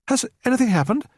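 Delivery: high, startled question; anxious at the door; a woman afraid of bad news; frightened. A narrator's reading. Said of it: "She sounded frightened".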